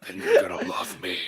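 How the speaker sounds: throat-straining deep growl. Retold or imitated. Actor repeating the line, throat-hurting mock Batman growl. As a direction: overdo a low, garbled superhero rasp. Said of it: "doing that voice".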